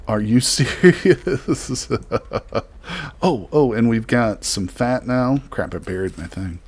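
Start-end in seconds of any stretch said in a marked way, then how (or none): 0.1-1.9 slow question breaking into laughter; laughing disbelief. Real, not acted. Player amazed at the meat haul, laughing disbelief. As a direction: crack up in amazement mid-question.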